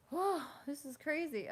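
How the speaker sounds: breathless from excitement; out of breath. Real, not acted. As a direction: talk a bit winded, excited. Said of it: "I'm, like, out of breath".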